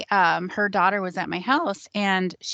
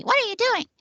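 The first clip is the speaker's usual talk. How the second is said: high-pitched imitation of an alarmed yell; retold shriek, pitched way up without full volume; mock yelling. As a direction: voice the outraged mother yelling. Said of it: "she starts yelling at me".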